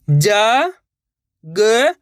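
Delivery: जा and ग each held long alone; slow, separated जा ... ग for pupils to repeat; letter by letter. Shown as a demonstration. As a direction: sound out जा, then ग, with a gap. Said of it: "एक ही अक्षर का उच्चारण करके".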